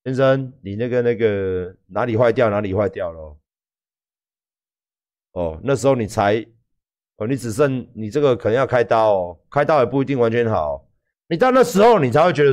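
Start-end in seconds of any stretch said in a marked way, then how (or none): 0.0-10.9 lower, quiet doctor giving a grim verdict; a hint of a sober doctor, voice dropped low; doctor delivering bad news. Doctor telling the patient he needs surgery, lightly voiced and subdued. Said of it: "那时候才说".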